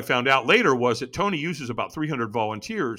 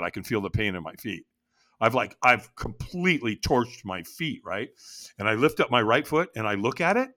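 usual speaking voice

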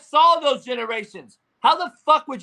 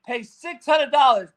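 ordinary delivery

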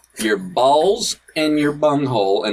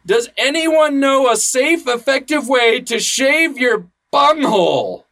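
mock earnest reading; 10 semitones higher than usual. Reading a silly post aloud.

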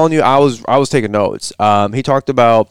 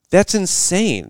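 quoting himself, pitched up high with an astonished "That's insane."; amazed exclamation; 7 semitones higher, much slower than usual. Retold or imitated. Host recalling the landscaping numbers, quoting his own astonished "That's insane.". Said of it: "I'm like".

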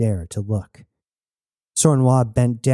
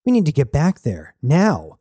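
urgent push, higher on "get back there", a separate hit on "now"; emphatic; 4 semitones higher than usual. A narrator's reading. Jazz pressing to return, emphatic stress on "now". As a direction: land "now" as its own emphatic word. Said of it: "she emphasized the obvious".

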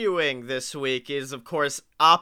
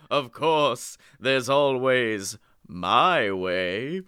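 very slow, sinister hanging hint; slow theatrical villain menace; 2 semitones lower, much slower than usual. Acted. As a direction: let the menace hang slowly. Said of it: "I'll take ghost host".

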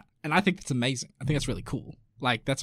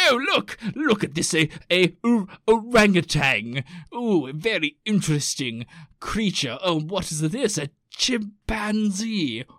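put-on curious old-timey Victorian; 3 semitones higher, 3 dB louder than usual. Old-timey visitor seeing gorillas first time, hammed-up curious wonder. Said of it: "first time they see gorillas and they're like".

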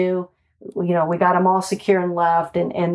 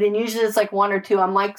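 ordinary talk, nothing marked

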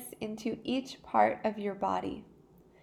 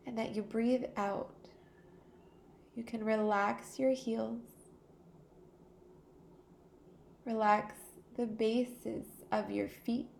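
calm meditation voice with long pauses; guided meditation; 3 dB quieter than usual. Real, not acted. Teacher easing listeners into a body scan, calm guided meditation. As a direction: guide them unhurriedly into stillness, pausing between phrases.

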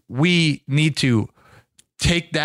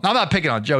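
normal talking voice

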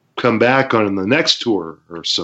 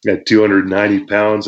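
ordinary delivery

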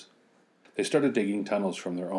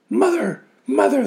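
very high, loud scream; 10 dB louder than usual. Acted. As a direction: scream for mother. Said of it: "they all screamed".

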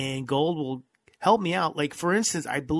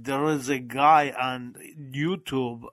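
put-on thick Russian accent. Telling cohost about a guy on YouTube, thick Russian accent. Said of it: "Russian accent".

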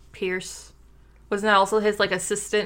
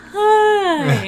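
high, loud, slurred hi; mimicking drunk hi; 12 semitones higher, 7 dB louder than usual. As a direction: tease him with a woozy drunk hi. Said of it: "You sounded all drunk, like".

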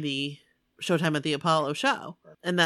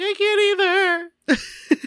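much higher, slow, with a crying catch; teary imitation; 13 semitones higher, 5 dB louder, much slower than usual. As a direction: wail it slowly as if half sobbing. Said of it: "And you're like".

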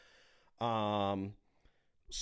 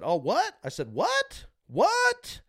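sky-high, louder retold "what?"; incredulous challenge; 6 dB louder, slower than usual. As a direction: act out disbelief, pitch leaping way up. Retold challenge to coach's threat.

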